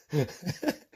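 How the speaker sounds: short real chuckle bursting out; amused chuckle at his own joke; real laugh. Real, not acted. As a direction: let a real giggle slip out. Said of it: "I'm sorry I'm laughing so much".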